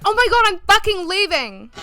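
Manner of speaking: high, exasperated outburst; fed-up exasperation. Retold or imitated. Girlfriend storming out on Ashton, high exasperated outburst. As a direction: throw it out and storm off. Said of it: "She's like".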